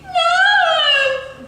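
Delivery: very high, loud, long scream; prince's piercing cry of refusal. A narrator's reading. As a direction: scream it in horror. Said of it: "the prince screamed".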